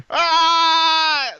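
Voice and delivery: high loud yell. Retold or imitated. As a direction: cap the rant with a shout. End of the Jason rant.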